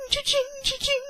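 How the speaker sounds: cha-ching imitation. Imitating a cash register noise.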